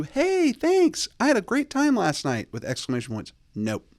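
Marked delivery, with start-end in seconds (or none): 0.0-2.5 very high, sing-song gushing voice; hammed-up bubbly thank-you; mock chirpy texter. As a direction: gush like an overexcited texter. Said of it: "Don't do".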